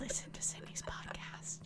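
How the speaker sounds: hushed whisper under the host's outro; whispered plug. Real, not acted. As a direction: slip in a very soft, whispered plug.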